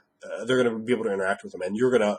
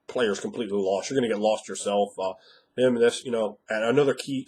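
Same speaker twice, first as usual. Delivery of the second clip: ordinary talk, nothing marked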